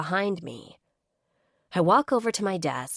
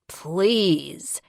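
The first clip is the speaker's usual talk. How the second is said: hiss; 4 dB louder than usual. A narrator's reading. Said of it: "he hisses out".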